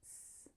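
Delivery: a soft, isolated hissed S; quiet sss for S; final consonant. Shown as a demonstration. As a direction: make the S alone, without voice. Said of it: "that last consonant sound".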